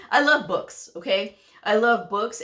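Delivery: ordinary delivery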